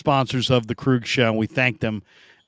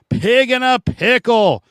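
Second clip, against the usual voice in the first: hyped announcer; 9 semitones higher, 4 dB louder, slower than usual. Acted. Title sponsor plug, announcer read.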